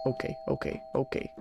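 breathless hushed okays; whispering while hiding. Real, not acted. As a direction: whisper to calm yourself down. Hiding after fleeing monster.

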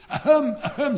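loud, very high fake throat-clearing ahem; pompous cough. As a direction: clear your throat grandly to be noticed. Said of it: "he began to cough".

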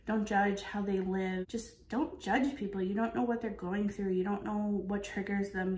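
usual speaking voice

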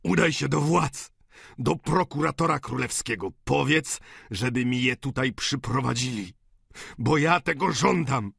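insistent demand. Acted. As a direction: demand it with desperate force. Dying father demands his daughters be brought. (natarczywe żądanie podniesionym głosem)

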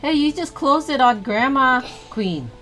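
ordinary talk

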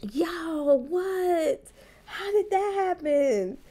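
high, drawn-out incredulous cry; dismayed disbelief. Real, not acted. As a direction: wail it in disbelief, pitch way up. Disbelief at failing the challenge at once.

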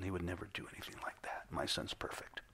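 father refusing to believe about his son, whispered; whispered denial. Retold or imitated. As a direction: hush it like a denying father.

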